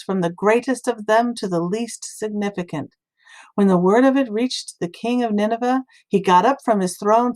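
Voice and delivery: ordinary delivery, nothing marked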